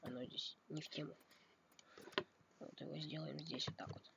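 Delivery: ordinary talk, nothing marked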